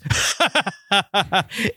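high, staccato imitation villain cackle; mock anime-style laugh for no reason; weird villain laugh. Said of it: "I love his laugh. That's just, like, so weird".

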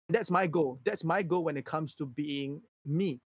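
ordinary talk, nothing marked

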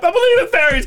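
high yelling chant; shouted plea. Pretend. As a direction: cry it out desperately. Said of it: "From Peter Pan".

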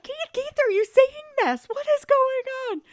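very high, loud squeal of disbelief; imitated tearful shock. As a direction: act out the crying aunt appealing to her husband. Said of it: "then she starts crying".

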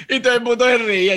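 laughing through the words, quick; can't stop laughing while telling it. Real, not acted. Laughing as he tells his friend about everyone laughing.

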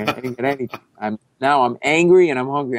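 normal talking voice

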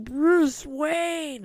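high, frantic scream of a name; panicked yell from behind bars. Retold or imitated. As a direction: scream it like he's losing his mind in a cell. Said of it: "he's freaking out and screaming".

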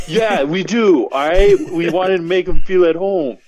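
hammed-up Boston townie voice; playful put-on Boston accent. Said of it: "that's a really good Boston accent".

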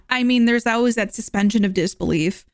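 usual speaking voice

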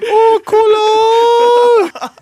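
hammed-up echo of the coworker's yell; mock exclamation. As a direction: wail it long like the coworker.

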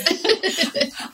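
loud high laugh; real burst of laughter. Real, not acted. Laughing at the mix-up.

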